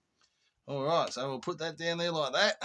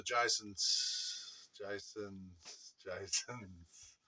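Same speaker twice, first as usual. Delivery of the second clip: echoing intro; 7 semitones lower, 9 dB quieter, much slower than usual. Pretend. Intro blooper before retake.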